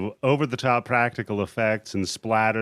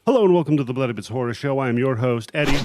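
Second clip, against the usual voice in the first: show host intro; 2 semitones higher, 4 dB louder, much faster than usual. Acted. Opening the podcast show.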